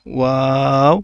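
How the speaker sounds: ওয়াও stretched long and loud; drawn-out waw. Shown as a demonstration. Teacher demonstrates a drawn-out ওয়াও, loud. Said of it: "ওয়াওটা টেনে পড়তে হবে".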